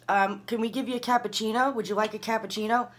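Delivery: high-pitched, put-on politeness; mimicking a condescending server; mocking offer. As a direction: imitate someone taunting with a fake offer. Mimics arrogant officers' taunting offer.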